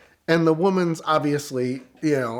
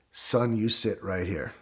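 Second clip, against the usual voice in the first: slow, low, soft command; lightly voiced stern officer; 5 semitones lower, 6 dB quieter, slower than usual. Officer ordering the driver to stay, quietly stern. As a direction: imitate a cop's quiet order, slightly drawn out.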